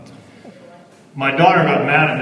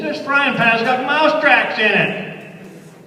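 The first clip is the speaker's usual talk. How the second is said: high, slow retelling; 12 semitones higher, slower than usual. Retold or imitated. Quoting himself, amused complaint.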